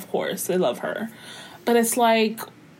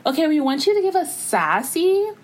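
mock brand rep; 8 semitones higher than usual. Voicing brands' demands to creators.

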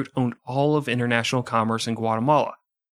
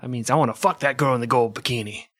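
Jabba the Hutt. Pretend. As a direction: talk like a bloated space gangster. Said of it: "Sounds like something Jabba the Hutt would say".

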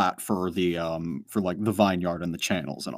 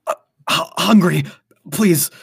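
high, strained, pleading voice; desperate plea; 8 semitones higher, 8 dB louder than usual. Acted. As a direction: beg like you're starving. GM voicing weak possessed Michelle.

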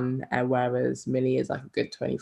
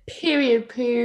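high, loud catchphrase delivery; mocking slang catchphrase; 10 semitones higher, 4 dB louder, slower than usual. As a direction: send up the slang, pitched right up.

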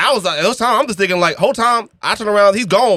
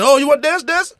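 high-pitched imitation of a pushy girl; pushy dancer; 11 semitones higher than usual. Girls pushing a dance on him, high pushy voice. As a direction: pitch it up, eagerly offering. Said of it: "they was real pushy there".

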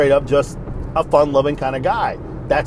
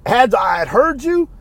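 high, slow, emphatic retort; drawn-out, indignant reply; retold angry comeback; 8 semitones higher, slower than usual. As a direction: act out an escalating comeback. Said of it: "so I went even worse".

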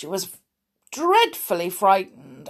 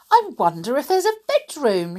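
lightly voiced wondering girl, higher and quicker; curious Goldilocks; 7 semitones higher, 3 dB louder, faster than usual. A narrator's reading. Goldilocks wondering about a bedroom, curious.

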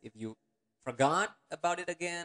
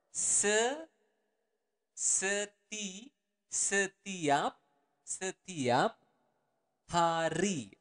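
slowed syllable by syllable; 5 semitones higher, much slower than usual. Shown as a demonstration. Teacher to learners, slow syllable build-up. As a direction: break setiap into Se-, seti-, then setiap, higher. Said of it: "let's repeat it again, slow, slower".

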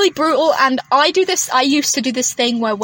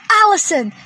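loud call across a car park; 8 semitones higher, 4 dB louder than usual. Retold or imitated. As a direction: shout her name across the lot. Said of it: "I went".

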